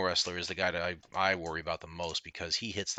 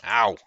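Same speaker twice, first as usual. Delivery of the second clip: sharp pained yelp; loud ow; 2 semitones higher, 12 dB louder than usual. Real, not acted. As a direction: yelp as if just hit. Hit by sumo.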